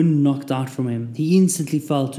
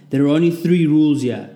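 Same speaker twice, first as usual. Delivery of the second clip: drill sergeant; 3 semitones higher, 6 dB louder than usual. A narrator's reading. Sergeant addresses recruits loudly.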